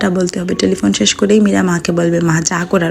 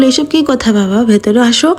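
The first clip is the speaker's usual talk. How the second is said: plain reading voice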